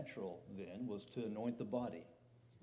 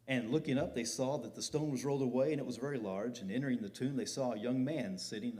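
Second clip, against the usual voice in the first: forceful scripture reading; 5 dB louder than usual. Acted. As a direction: preach the verses out loud and ringing. Reads scripture aloud with force.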